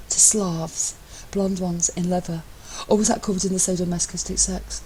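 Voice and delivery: a hint of smug superiority; lightly voiced smug Matt. A narrator's reading. Matt correcting husband, lightly voiced smugness. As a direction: savour being one step ahead.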